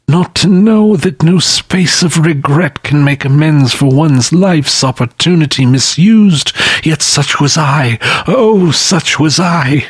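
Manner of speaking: high, lamenting phantom voice; wailing ghost. A narrator's reading. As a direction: mourn like a restless spirit. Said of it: "cried the phantom".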